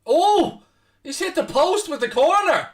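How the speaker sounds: leaps up high and loud as the ball strikes the post; real burst of commentary excitement; excited shout. Real, not acted. Commentator shouts at the ball hitting the post, excited.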